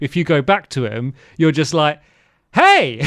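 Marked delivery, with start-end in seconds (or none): 2.5-3.0 high, loud hammed-up greeting; mock cheery hail. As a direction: pop back up with a cheeky shout. Acting out cheeky return to trapper.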